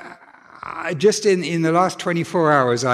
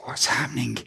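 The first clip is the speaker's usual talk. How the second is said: hushed whisper; 3 semitones lower, 7 dB quieter than usual. Retold or imitated. Child's frightened thought, retold.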